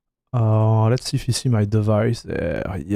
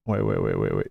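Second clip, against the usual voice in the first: very low deep wait wait; 7 dB quieter, faster than usual. Pretend. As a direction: drop into a deep mock voice. Stops viewers seeing screen.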